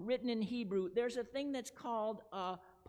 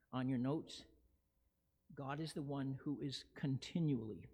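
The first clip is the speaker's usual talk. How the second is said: hushed devotional preaching; 9 semitones lower, 4 dB quieter than usual. Real, not acted. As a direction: draw them in with hushed reverence. Slow quiet devotional close.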